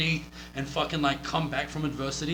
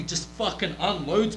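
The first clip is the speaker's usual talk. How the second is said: normal talking voice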